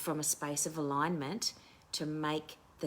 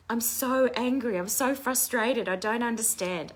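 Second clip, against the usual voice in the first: higher and louder, wound-up frustration; angry outburst; 7 semitones higher, 6 dB louder than usual. Retold or imitated. Retelling her tapping rant about her sister, angry and loud. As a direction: let the anger spill out while tapping. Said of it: "I was like".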